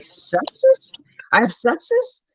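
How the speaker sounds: very high, rising disbelief; incredulous question. Retold or imitated. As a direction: squeak it out as a startled question. Retold shocked reaction to diagnosis.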